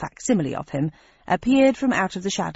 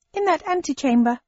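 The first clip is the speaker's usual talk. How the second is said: higher, odd creature voice; high shape-changer voice; 8 semitones higher than usual. Acted. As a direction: pitch it up as the shifter.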